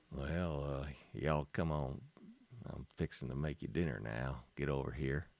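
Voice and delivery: low, Southern country mama calling folks to dinner; Southern drawl. Acted. Teacher voicing a type style to students, Southern drawl. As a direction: drawl it like a ranch cook hollering. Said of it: "What kind of voice do you hear?".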